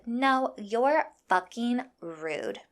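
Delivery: slow, word-by-word comeback; emphatic retort. Retold or imitated. Retold comeback to a rude line-cutter, slow and emphatic. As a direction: spell it out slowly to put her down.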